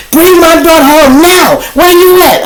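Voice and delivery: high, loud, shrill-free demanding mom voice; mother demanding her daughter home, high and loud; angry mother. Retold or imitated. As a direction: play the frantic mom yelling down the phone. Mother yelling on phone.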